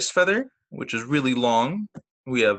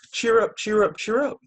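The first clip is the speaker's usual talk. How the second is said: high chirpy robin call; sing-song birdcall up high; 10 semitones higher, 4 dB louder than usual. Retold or imitated. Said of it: "That is the song of a robin".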